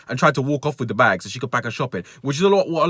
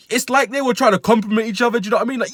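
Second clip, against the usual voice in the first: ordinary talk, nothing marked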